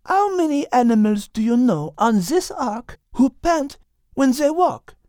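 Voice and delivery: high French cat voice, arguing; French-accented cat reasoning; Liz, French accent. Acted. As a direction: counter him calmly in French accent. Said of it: "Liz argued".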